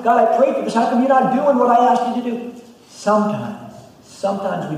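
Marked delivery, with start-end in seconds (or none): 0.0-2.5 high, loud complaint to God; complaining to God. Retold or imitated. Believer protesting to God about a prayer, high and loud. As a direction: whine at God for the unanswered prayer. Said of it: "Aren't we?".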